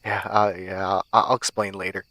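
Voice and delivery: low, soft, hesitant reassurance; quiet, halting answer through a weak smile. A narrator's reading. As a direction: brush it off feebly, stumbling a little. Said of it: "I said with a weak smile".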